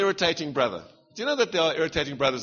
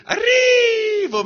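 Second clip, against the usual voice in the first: one syllable held very high, long and loud; Malagasy way of talking; 7 dB louder, much slower than usual. Shown as a demonstration. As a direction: stretch the REE of "Arrivo" into a high, loud wail. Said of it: "that's how they talk".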